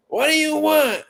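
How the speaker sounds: high impatient order-taker voice; impression of takeout lady. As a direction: bark it like the woman on the phone. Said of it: "She be like".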